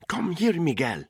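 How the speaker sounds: high, urgent call; a mother calling her son away, high and urgent; anxious mother. A narrator's reading. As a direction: pull your child to you.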